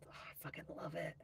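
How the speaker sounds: gushing whisper, fast. Real, not acted. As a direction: whisper the love for the song. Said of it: "whispered gush about the song".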